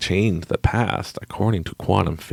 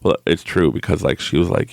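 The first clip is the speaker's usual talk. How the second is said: ordinary talk, nothing marked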